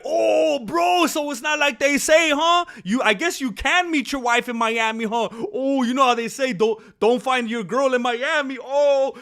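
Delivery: loud, high teasing from the guys; hammed-up mocking jeer; mocking banter. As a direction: rib him with big mock-shocked whoops. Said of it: "they were laughing about it".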